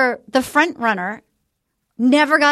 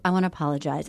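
much lower, softer mock apology; mock Matt; 11 semitones lower, 7 dB quieter, much faster than usual. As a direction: murmur it low like a sheepish guy.

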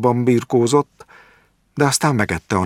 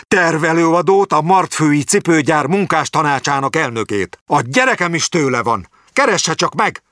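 shouting; 8 semitones higher than usual. A narrator's reading. Old Jáki yelling at the doctor about the book. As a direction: rant it at the doctor, raised and high. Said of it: "Jáki már ordított".

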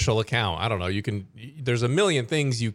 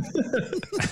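short high-pitched giggle; 4 dB quieter than usual. Real, not acted. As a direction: burst into a little giggle. Reacting to Christmas miracle joke.

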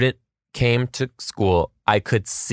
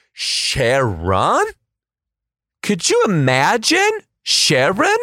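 rising disbelief; 7 semitones higher, slower than usual. Acted. Teacher reacting to Cher being called Sharon, rising disbelief. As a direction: climb up in outrage and amusement.

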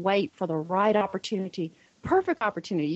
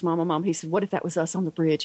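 ordinary delivery

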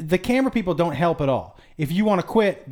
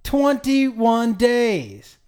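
every word of "Twenty-one days" stretched out, higher; drawn-out disbelief; 5 semitones higher, much slower than usual. Real, not acted. Host repeats the survival time to friend, drawn-out disbelief.

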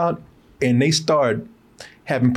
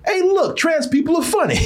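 mocking. Mocking the movie's attitude.

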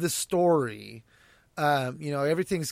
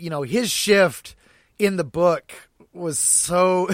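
usual speaking voice

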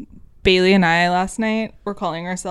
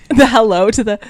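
ordinary delivery

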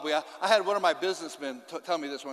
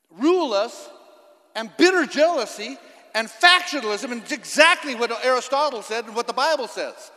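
forceful preaching; 4 semitones higher, 6 dB louder than usual. Acted. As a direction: hammer the politicians from the pulpit.

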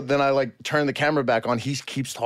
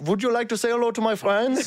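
high, put-on German question; German accent; 8 semitones higher than usual. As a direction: ask politely in a comic German accent. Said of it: "send them a video in this accent".

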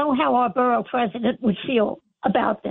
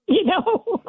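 laughing; 7 semitones higher than usual. Real, not acted. Caller laughs at own age.

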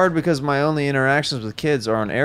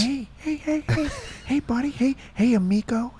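high, soft, repeated coaxing; softly pitched-up soothing impression; Owen Wilson; 14 semitones higher, 4 dB quieter than usual. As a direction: hush a kid in a high actor imitation. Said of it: "like Owen Wilson calming kids down on planes".

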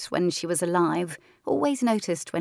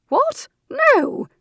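very high, loud startled cry; shocked; 9 dB louder than usual. Acted. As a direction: react in sudden alarm. Louise shocked.

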